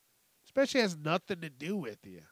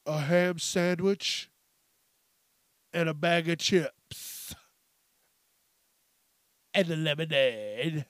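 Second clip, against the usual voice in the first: mock customer order; 5 dB louder than usual. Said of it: "there's people that do".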